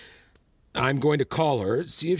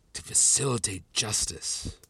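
ordinary talk, nothing marked

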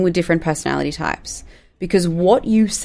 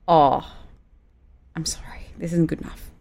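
soft, gentle correction; 6 dB quieter than usual. Shown as a demonstration. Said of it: "You could say it like".

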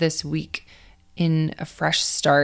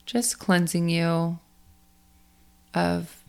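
guided meditation; 4 dB quieter than usual. Acted. Coach guides athletes to let go of last week, in a guided meditation voice. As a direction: ease listeners through the breathing, unhurried and spacious.

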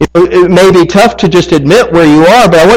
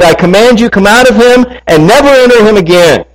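higher-pitched, firm command; stern rebuke; 6 semitones higher than usual. Acted. Jesus casting out an evil spirit, commanding rebuke. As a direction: order it out with rising authority. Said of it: "he rebuked the evil spirit".

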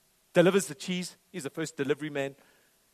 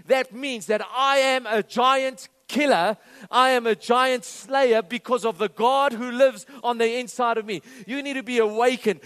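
loud, driving preacher's proclamation; khotbah berapi-api; 7 semitones higher, 9 dB louder than usual. Acted. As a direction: preach it at full force, building higher and louder. Preaches at full force to congregation.

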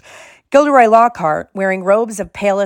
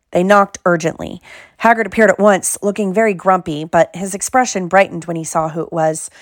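usual speaking voice

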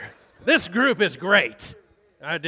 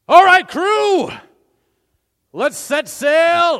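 captain's call; 7 semitones higher, 9 dB louder, slower than usual. Acted. Captain calls the crew.

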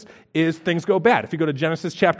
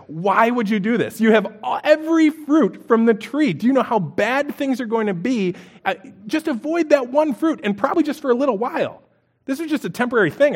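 exasperated pleading; 6 semitones higher than usual. Retold or imitated. Exasperated plea to Adam and Eve.

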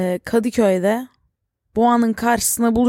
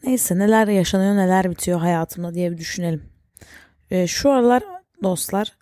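usual speaking voice

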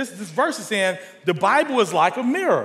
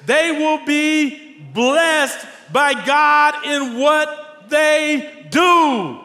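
scripture reading building; 5 semitones higher, slower than usual. Acted. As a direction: let the promise of blessing swell.

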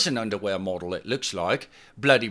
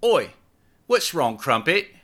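gently; 8 semitones higher, 3 dB louder than usual. Acted. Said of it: "she asked gently".

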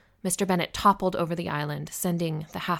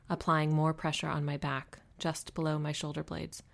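plain reading voice

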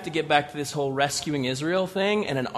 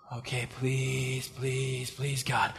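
much softer, slow begging prayer; quiet pleading; 6 semitones lower, 11 dB quieter, much slower than usual. Acted. Gideon begs God before the water test, softly. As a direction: beg under your breath.

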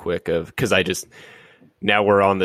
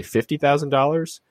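"Fifty thousand dollars" echoed high with a rising tune; mirroring question; 7 semitones higher than usual. Shown as a demonstration. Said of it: "do that mirroring and say".

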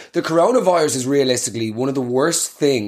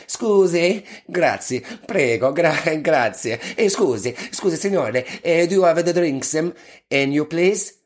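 mock Italian accent. Shown as a demonstration. Ordering drinks at the bar in mock Italian accent. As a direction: put on a broad comic Italian accent. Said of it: "order your drinks in a northern Italian accent".

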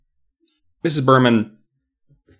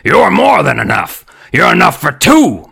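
loud, higher jeering puppet voice; nasty dummy; 4 semitones higher, 7 dB louder than usual. A narrator's reading. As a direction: declare it nastily as the dummy. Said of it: "Mister Wood declared nastily".

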